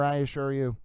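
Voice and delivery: ordinary talk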